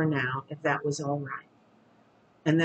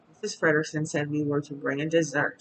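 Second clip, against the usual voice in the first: ordinary delivery, nothing marked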